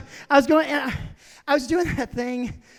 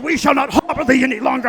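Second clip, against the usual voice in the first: loud, fast mock-British outburst; British skateboard; 9 dB louder, faster than usual. Skateboard refusing its rider, mock British. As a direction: declare it in a pompous British accent. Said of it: "you're British".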